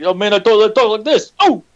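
high-pitched comic tough-talker impression; hammed-up stand-up catchphrase delivery, pitched well up; Andrew Dice Clay. Co-host teasing his friend's voice, Andrew Dice Clay impression. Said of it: "you're talking like Andrew Dice Clay".

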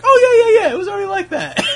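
mock breezy denial. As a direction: ham up a too-eager innocent yes. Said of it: "you were just gonna be like".

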